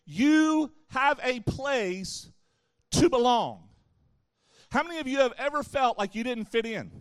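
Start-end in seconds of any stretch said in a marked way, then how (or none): 0.0-3.7 very slow, YOU drawn out, pause before "to belong"; emphatic repeat. Real, not acted. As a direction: land "to belong" loud and deliberate after a pause. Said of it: "Please hear me when I say that".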